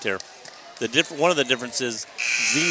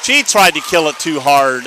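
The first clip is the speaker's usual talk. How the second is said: excited exclamation; 4 semitones higher, 11 dB louder, slower than usual. Real, not acted. Missed kill, loud reaction.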